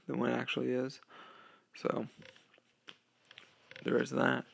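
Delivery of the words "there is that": ordinary talk, nothing marked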